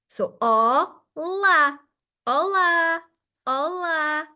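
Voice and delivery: olá with a wide open O and a wide open A, slow; open big O and A. Shown as a demonstration. Teacher models olá for students, open O and A. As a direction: stretch out olá, mouth wide open on both vowels. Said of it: "you're gonna do a big O and a big A".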